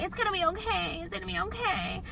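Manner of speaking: high, soft, mock-comforting coo; mock soothing. Boss soothing the social media girl, mock soothing coo. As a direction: hush it like calming a child.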